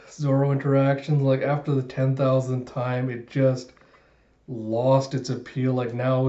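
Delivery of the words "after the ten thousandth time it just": normal talking voice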